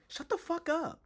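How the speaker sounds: very high-pitched mocking imitation; mock outburst. Streamer imitating the actor from the clip, mocking. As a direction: squeal it as a send-up of bad acting. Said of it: "He's just like".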